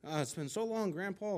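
put-on high, thrilled old-lady exclamation; excited grandma. Grandma reacting to Grandpa's kiss, excited. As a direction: act out a grandma all aflutter. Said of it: "Grandma was really excited".

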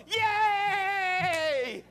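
high, loud imitated little girl's cheer; child's cheer. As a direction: squeal like a delighted five-year-old. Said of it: "she's going".

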